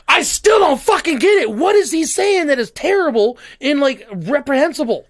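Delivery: high, loud frustrated yelling; exasperated shout. Retold or imitated. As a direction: shout it out in disbelief. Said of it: "And I'm like".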